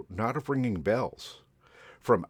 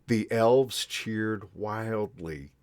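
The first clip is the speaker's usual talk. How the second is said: ordinary delivery, nothing marked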